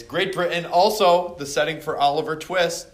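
usual speaking voice

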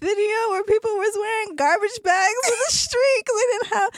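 high, laughing through the words; helpless laughter. Real, not acted. Telling her co-host about garbage bags, laughing helplessly. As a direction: try to talk while cracking up. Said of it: "It's not funny".

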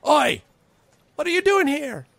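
high-pitched goblin challenge; high, loud goblin sentry; goblin guard. Acted. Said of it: "one of them says".

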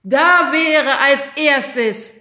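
high, loud and drawn out; auctioneer call. A narrator's reading. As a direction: announce into the microphone. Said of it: "rief der Herr ... ins Mikrofon".

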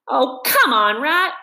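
impatient. A narrator's reading. Mole waiting on the digging rat, impatient urging. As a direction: hurry him up, fed up. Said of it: "the mole waited impatiently".

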